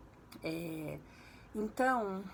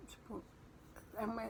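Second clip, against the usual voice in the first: usual speaking voice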